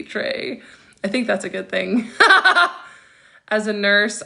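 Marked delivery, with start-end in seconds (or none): 2.1-2.8 high, loud short laugh; quick high-pitched cackle; burst of laughter. Real, not acted. Host tickled by a viewer's compliment, laughing out loud.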